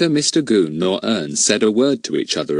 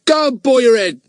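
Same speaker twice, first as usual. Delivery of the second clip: high, louder scornful brush-off; disgusted; 6 dB louder than usual. Acted. Ern dismisses Fatty rudely, high and disgusted. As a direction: fling it out in disgust. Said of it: "in a disgusted voice".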